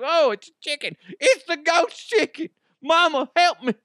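high, loud panicked wail; hammed-up scared cry for mama; mock terror. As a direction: shriek in pretend fright at a silly ghost. Acts sleeper terrified by ghost chicken.

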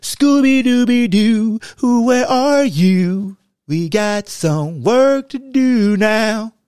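high, loud, slow sing-song calling; mock the defense with a cartoon call; cartoon Scooby call. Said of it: "it was".

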